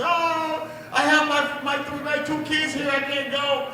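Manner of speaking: high-pitched wailing plea, imitating a sister; mock crying. Sister pleads with police about her kids, mock crying. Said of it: "My sister starts crying".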